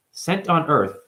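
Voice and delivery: ordinary delivery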